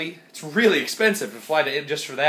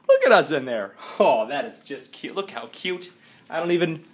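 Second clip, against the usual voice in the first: ordinary talk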